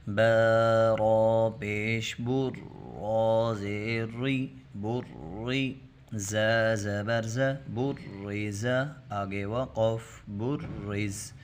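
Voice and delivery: slow drawn-out syllable recitation; demonstration reading. Shown as a demonstration. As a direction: model the reading for the pupils. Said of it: "جیسے". (ہجے پڑھ کر دکھانا)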